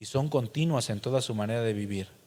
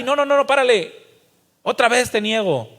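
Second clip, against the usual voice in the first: loud, high, rushed surrender; a believer under the lash, shouting his denial again; frantic giving in; 9 semitones higher, 8 dB louder than usual. Retold or imitated. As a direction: beg them to stop, then cave. Beaten man gives in again.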